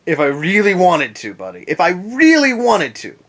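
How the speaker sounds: real rising indignation; getting heated. Real, not acted. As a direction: get worked up as you argue back. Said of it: "now I'm getting a little heated".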